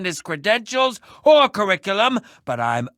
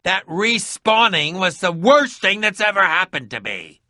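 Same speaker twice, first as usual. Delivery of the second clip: ordinary delivery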